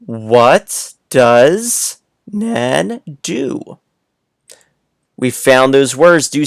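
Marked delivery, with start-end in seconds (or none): 0.0-3.8 very slow, every word stretched; very slow reading aloud. Shown as a demonstration. Teacher rereading the sentence very slowly for kids. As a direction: drag each word out so kids can listen. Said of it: "let's read this sentence again".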